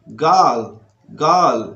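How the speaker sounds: put-on girl said slowly and clearly as a model word; model pronunciation. Shown as a demonstration. Teacher modelling girl for Hindi learners. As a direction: say girl cleanly for students to repeat. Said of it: "इस तरह की उच्चारण आपको करना है".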